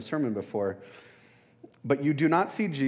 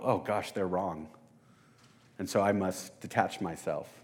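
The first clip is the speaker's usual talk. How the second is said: smug self-righteous ego; 4 semitones lower, 4 dB quieter than usual. Retold or imitated. Preacher mocking his past bitter self, lightly smug. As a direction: sneer lightly at the wrong people. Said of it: "It was all my ego".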